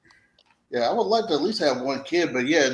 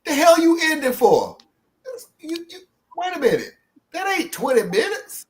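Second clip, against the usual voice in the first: high, indignant outburst; outraged, high-voiced protest; cussing out; 13 semitones higher than usual. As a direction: cuss out the screen in high-pitched disbelief. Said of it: "cussed that YouTube video out".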